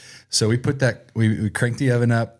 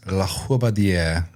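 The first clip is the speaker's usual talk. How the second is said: La Robardier in a French accent; French pronunciation. Shown as a demonstration. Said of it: "I think the French say it like this".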